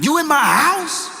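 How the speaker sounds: high-pitched, ready-to-fight question; mock confrontational challenge. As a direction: act outraged, pitch shooting up. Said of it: "Bass in voice, ready to beat somebody down".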